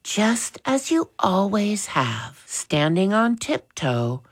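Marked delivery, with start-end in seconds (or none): 0.0-2.4 higher, brightened mother's voice. A narrator's reading. Mother to father, trusting him to decide, brightened. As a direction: lift the voice brightly to show trust. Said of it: "Mother's voice brightened".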